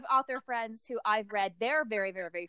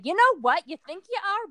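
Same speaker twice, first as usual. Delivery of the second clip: higher, playful call-out; teasing challenge; 9 semitones higher than usual. Acted. Beta reader challenging the author's self-image, teasing challenge. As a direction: lift it high, as if catching someone out.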